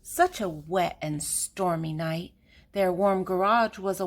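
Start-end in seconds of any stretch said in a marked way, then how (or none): none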